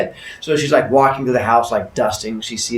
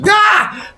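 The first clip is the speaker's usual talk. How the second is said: high, loud mock scream; startled yelp; 5 dB louder than usual. Woman tripping over a box, high startled yelp. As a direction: cry out as you trip.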